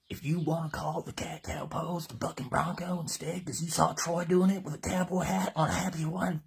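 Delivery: high, building enthusiasm; excited hype. Real, not acted. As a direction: drive it upward to a loud finish. Hyping the bucking bronco name.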